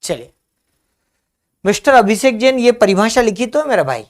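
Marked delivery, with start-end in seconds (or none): 1.7-4.0 higher, louder call to a student. Real, not acted. Teacher calls a student who missed the definition, loudly. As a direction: catch his attention, a bit exasperated.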